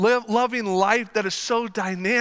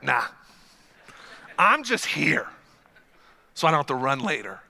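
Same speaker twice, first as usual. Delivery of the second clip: grumpy; 8 semitones lower than usual. Retold or imitated. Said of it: "just grumpy to be there".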